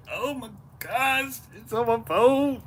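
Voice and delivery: high slow dismayed groan; drawn-out high "oh my gosh". Real, not acted. Sardine juice on his phone.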